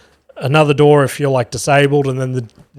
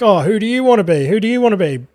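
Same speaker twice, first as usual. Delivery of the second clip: mock cheery staff; 6 semitones higher, faster than usual. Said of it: "are like".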